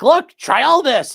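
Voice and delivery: high, loud, drawn-out mock demand; hammed-up insistent yell; imitated pushy client. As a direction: push it at them loudly, high and slow. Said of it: "he gave them, like".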